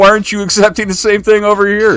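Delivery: high-pitched, incredulous rant; exasperated retort. Retold or imitated. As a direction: argue back at stubborn fans, pitch climbing. Argues back at imagined fans.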